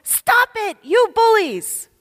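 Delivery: put-on high childlike yell at the bullies; a small girl standing up to older boys; little girl yelling. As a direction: shout it like a fearless toddler. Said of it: "And she yells".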